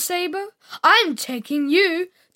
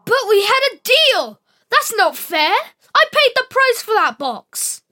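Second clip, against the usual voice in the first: indignant protest; 6 dB louder than usual. Acted. Spider protesting unfair deal.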